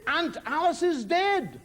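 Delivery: high, slow proclamation of bad news; grave announcement. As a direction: break the news slowly and dramatically. Father announces a death, acted.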